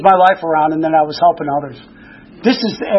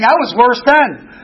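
ordinary talk

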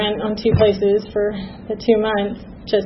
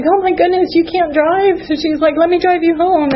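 lightly voiced worried store clerk, pitched higher; a kind stranger fussing over her, a bit higher; concerned clerk; 8 semitones higher than usual. Store clerk urging the crying customer not to drive, a hint of worried and higher.

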